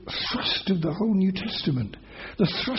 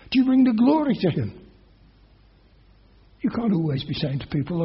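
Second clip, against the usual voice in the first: normal talking voice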